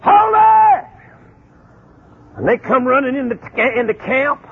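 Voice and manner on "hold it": loud, higher shouted hold it; calling out. Retold or imitated. Two men shouting at the fleeing spies to stop. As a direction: yell for the fellas to stop. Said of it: "They said".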